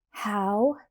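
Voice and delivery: ordinary delivery, nothing marked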